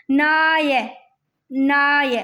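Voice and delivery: "नाय" drawn out, long aay; sounded out. Shown as a demonstration. Teacher pointing out a sound, "नाय" stretched. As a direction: hold "नाय" so the aay rings. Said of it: "आय की आवाज आ रही है".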